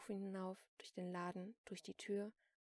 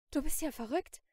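ordinary delivery

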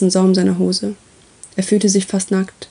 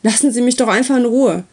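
raised pitch, restrained narrated shout; exasperated shout; 4 semitones higher than usual. A narrator's reading. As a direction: plead angrily to be left alone. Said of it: "schrie Uri". (aufgebrachter, höherer Ausruf)

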